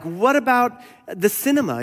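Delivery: high-pitched, lightly acted appeal; mock pleading. Academic visitor appealing to Bonhoeffer, mock pleading.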